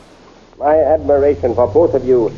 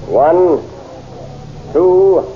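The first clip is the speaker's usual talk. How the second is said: building countdown; 5 semitones higher, much slower than usual. Acted. Prince counts down the duel.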